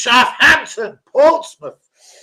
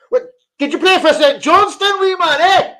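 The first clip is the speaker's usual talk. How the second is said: high, loud mock Scottish voice; 10 semitones higher, 5 dB louder than usual. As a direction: put on a Scottish accent, teasing. Mock Scottish to St Johnstone viewer.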